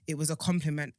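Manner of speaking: ordinary talk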